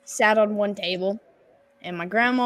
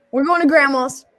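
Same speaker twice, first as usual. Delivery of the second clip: loud, higher announcement; imitated dad announcing; 5 semitones higher, 6 dB louder than usual. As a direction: call it out as dad. Voicing dad's announcement.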